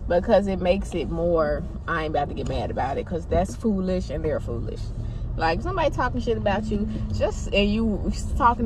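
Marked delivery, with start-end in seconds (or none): 1.9-3.1 annoying voice. Retold or imitated. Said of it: "that annoying voice that I do".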